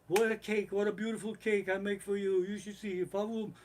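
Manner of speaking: high-pitched mock baker, gushing over the cake; baker boasting. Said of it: "and the Baker's like".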